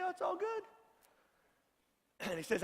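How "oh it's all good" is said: a much higher, breezy put-on shrug; mock breezy excuse. Mocking those who dodge caring for parents, breezy. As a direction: toss it off like it's nothing.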